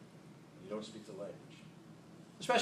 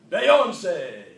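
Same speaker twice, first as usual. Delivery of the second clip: mock French accent; 4 semitones higher, 12 dB louder than usual. Shown as a demonstration. Speaker showing off his French vocabulary in a hammed-up accent. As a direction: belt it like a proud fake Frenchman. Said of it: "my entire French vocabulary".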